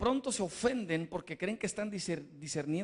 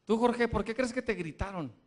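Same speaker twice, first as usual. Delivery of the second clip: ordinary talk, nothing marked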